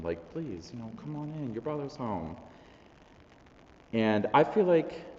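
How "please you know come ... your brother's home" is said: soft, higher-pitched gentle plea; pleading. Acted. Father begging older son to come inside, gently pleading. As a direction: coax softly, mildly begging. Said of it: "begging and pleading him".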